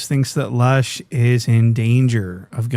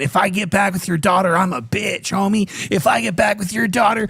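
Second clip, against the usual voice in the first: higher mocking imitation of Lush; mocking impression; 5 semitones higher than usual. Commentator mocks Lush's call to her dad, higher mock voice. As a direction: parrot his words back in a whiny mock voice. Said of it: "on the phone with her dad saying".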